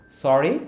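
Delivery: "সরি" rising as a question; rising one-word question. Shown as a demonstration. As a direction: ask "সরি" with a lift. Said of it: "বলেন এভাবে".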